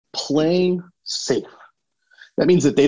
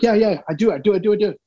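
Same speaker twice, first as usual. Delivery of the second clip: eager yes-man; 7 semitones higher, faster than usual. Retold or imitated. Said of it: "And you're like".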